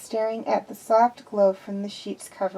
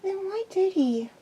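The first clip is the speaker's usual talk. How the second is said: small voice, high and hurt; a child asking why, stung; hurt little girl; 10 semitones higher, 3 dB quieter than usual. Acted. Hurt Omega questions Wrecker.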